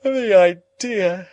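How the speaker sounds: a fluting society lady stifling a yawn; loud, slow and affected; Camilla, checking a yawn. A narrator's reading. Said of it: "checking a yawn".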